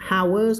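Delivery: "hours" said as "ours", no h sound; silent h. Shown as a demonstration. Teacher correcting students who say the h in hours. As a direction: drop the h in "hours". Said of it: "คำนี้อ่านว่า hours".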